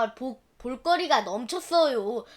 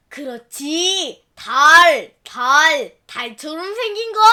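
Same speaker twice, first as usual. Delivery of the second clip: louder triumphant cry of remembering; eureka shout; 7 dB louder, slower than usual. Acted. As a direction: burst out as it comes back.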